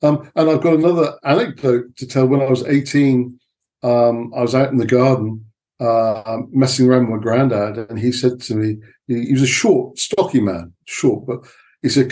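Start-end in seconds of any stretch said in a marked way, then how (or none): none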